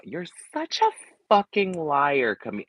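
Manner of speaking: high, slow dramatic accusation; imitating a Housewife. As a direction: squeal it like a reality star. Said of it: "I wish she would have delivered it better".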